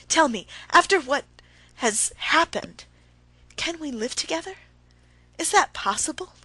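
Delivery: rising to a loud, high plea; wife raising her voice at her husband. A narrator's reading. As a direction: push the voice up as she demands. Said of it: "raising her voice".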